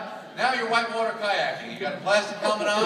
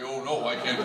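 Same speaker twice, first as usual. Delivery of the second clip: woman imitating man; 9 semitones lower than usual. Wife imitating her husband mid-argument, mocking low man voice. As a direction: drop low to mimic your husband. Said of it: "imitate the voice of the man".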